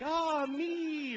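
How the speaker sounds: high, breathy mock swoon; dreamy woman. Guy mocking women's self-pleasure, lightly swooning. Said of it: "They're like".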